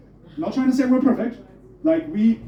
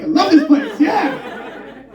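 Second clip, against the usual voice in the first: higher, louder, laughing cheer; giddy joy; 8 semitones higher, 4 dB louder, much slower than usual. Retold or imitated. Tourist leaving the coffee shop, cheering higher and louder. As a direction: burst out happy after high-fiving a cop. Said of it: "you're like".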